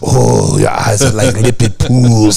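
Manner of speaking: put-on low, slow French-accented lover voice; hammy French accent, deep and slow; Pepe Le Pew. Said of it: "little Pepe Le Pew".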